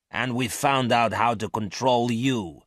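a hint of cold hard menace. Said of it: "in a cold, hard voice".

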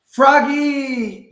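loud high shout across the field; yelling. A narrator's reading. Dad yells to Froggy from the stands, loud shout. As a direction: yell his name from the stands. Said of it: "yelled his dad".

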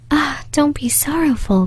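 Beauty begging the sad prince not to grieve; pleading cry. Acted. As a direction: lift the voice to console him. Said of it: "cried Beauty".

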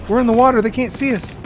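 high, loud panicked calling; frantic shout. Real, not acted. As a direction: yell orders in a panic. Frantic calls during firefight.

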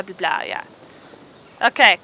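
usual speaking voice

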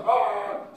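loud, high held blare; horn blast imitation. Imitating the shofar coming again, horn blast. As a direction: sound it like a blown horn.

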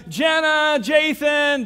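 high, loud shout down the street; frantic shout for the missing siblings; shouting names outside. Retold or imitated. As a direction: yell their names outside. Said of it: "He's calling outside".

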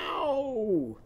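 high, falling, drawn-out cry of ow; mock yelp from a bumped head; pretend pained ow. Teacher voicing Owl bumping his head, drawn-out pretend ow. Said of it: "he's bumping his head".

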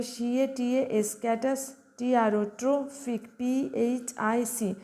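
ক্যাটাস and ট্রোফিক spelled out slowly, piece by piece; teacher breaking a word apart for students; broken into syllables. Shown as a demonstration. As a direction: split catastrophic into chunks for dictation. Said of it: "আমি যেভাবে ভেঙে ভেঙে বলছি".

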